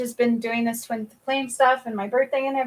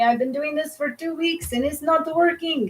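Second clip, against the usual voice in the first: a weak attempt at Deepak Chopra's Indian accent; 5 semitones higher than usual. Deepak Chopra quoting his students, in a faint Indian accent. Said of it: "His accent, I can't do well".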